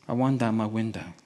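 usual speaking voice

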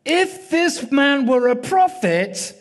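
high, slow, declaimed disapproval; Pharisee's inner scorn. Retold or imitated. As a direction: play the Pharisee pronouncing judgment, drawn out. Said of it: "he said to himself".

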